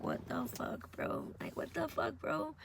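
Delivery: whispered, drawn-back incredulity; very soft, airy stunned whisper; hushed disbelief. Retold or imitated. Stunned late-night reaction to herself.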